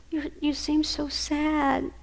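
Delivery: soft, slow comfort; tender. Real, not acted. Grandma soothing her crying grandson, soft and slow. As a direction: say it slowly, softly, full of care.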